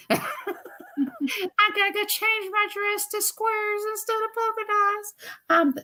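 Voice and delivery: high, slow laughing puppet voice; Minnie the puppet. Acted. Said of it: "I have fun with that puppet".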